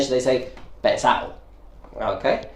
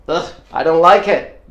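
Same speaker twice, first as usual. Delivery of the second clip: disgusted groan; 4 dB louder than usual. Retold or imitated. Example of being disgusted.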